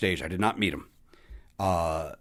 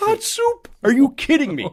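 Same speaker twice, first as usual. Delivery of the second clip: far higher, loud incredulous squeal; delighted mock disbelief; 9 dB louder than usual. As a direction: shriek it like you can't believe it. Repeating the Rickles joke, delighted.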